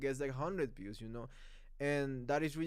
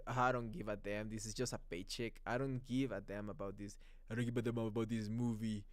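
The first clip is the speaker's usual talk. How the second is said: low, lightly mocked couldn't-care-less voice; lazy actor; 5 semitones lower, 4 dB quieter than usual. Imitating a famous actor slacking on a movie, lazy indifference. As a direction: imitate an actor phoning it in, pitched down. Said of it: "he's lazy on this one".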